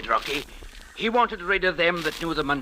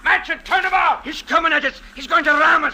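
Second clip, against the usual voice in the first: loud high alarm; loud frantic warning; panicked shouting; 13 semitones higher, 8 dB louder than usual. Acted. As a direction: yell as the ship bears down. Ship about to ram them.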